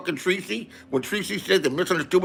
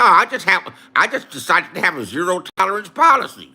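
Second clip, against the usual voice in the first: ordinary talk, nothing marked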